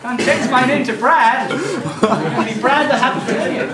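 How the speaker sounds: teacher teasing students as Brad, laughing; happy Canadian. Pretend. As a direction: ham up a jolly Canadian persona. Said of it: "teach like a happy Canadian".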